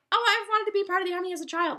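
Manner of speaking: high, quick mocking put-on voice; mock eager claim. Mimicking a boyfriend's supposed childhood army dream, mock eager. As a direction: gush it in a high, hurried mock voice.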